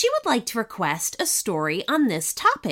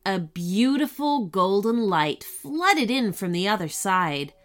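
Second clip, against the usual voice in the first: ordinary delivery, nothing marked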